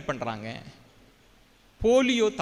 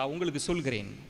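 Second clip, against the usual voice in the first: ordinary talk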